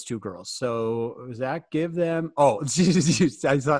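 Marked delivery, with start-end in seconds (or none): 2.4-3.8 amused, words shaken by laughter; laughing. Real, not acted. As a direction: break into a laugh while talking. Amused at students' faces.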